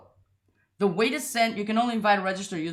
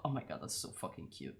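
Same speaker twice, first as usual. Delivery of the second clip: breathless unvoiced gush over something cute; overwhelmed by cuteness, whispering; whispered gush; 7 semitones lower, 14 dB quieter than usual. Real, not acted. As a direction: melt over it under your breath. Gushing over cute image.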